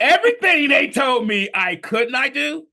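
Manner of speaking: high, loud and emphatic; loud defiant declaration. Real, not acted. Speaker recounts beating the doctors' limits, loud and defiant. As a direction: belt it out in defiance.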